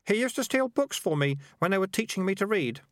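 put-on high-pitched, simple-hearted boy; boy character standing up for his rat; earnest story boy. A narrator's reading.

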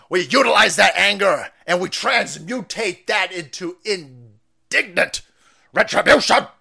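lightly voiced AOC speech, hammed up; mimicking a congresswoman's speech; AOC impression. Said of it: "gonna do my A O C".

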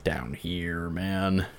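ordinary talk

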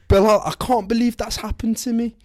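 high Birmingham lilt, playing the upset wife; a put-on Brummie voice pitched well up; Brummie accent. Upset wife complains to husband, Brummie accent. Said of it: "Why do I sound brummy?".

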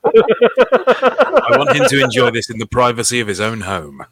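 mock earnest. Mock sentimental wish.

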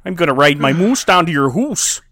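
deliberate, hammy Canadian threat; mock Canadian accent. Host threatening co-host with a moose ride, mock Canadian. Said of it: "this is a pretty good Canadian accent".

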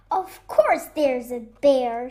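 loud, emphatic retort; dismissive shout. Acted. Squirrel answers Fox's warning, loud dismissive shout. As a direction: shout it back as if obvious. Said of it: "shouted Squirrel".

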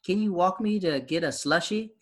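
high, slow, sweet little sister asking; little girl voice. Retold or imitated. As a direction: imitate a young girl asking a favor. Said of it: "She's like".

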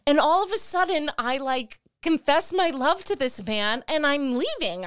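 high, loud exasperated protest; irritated at God. Retold or imitated. Woman railing at God in her car, exasperated and loud. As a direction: throw the question up at God in frustration. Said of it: "Now you're even more irritated".

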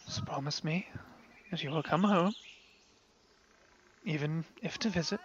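soft, choked-up farewell; a father quietly fighting back tears; tearful father. Acted. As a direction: hold back tears as you speak. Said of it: "father's eyes begin welling up with tears".

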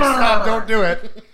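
loud, slow hammed-up plea; mock pleading president. President calling off the bombing, mock desperate yell. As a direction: drag it out in a comic yell. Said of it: "So he's just like".

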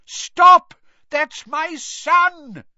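very loud, high, stretched shout; desperate yell. Acted. Father shielding his son from wizards, desperate yell. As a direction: shout frantically to protect your child. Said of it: "yelled a voice he recognized".

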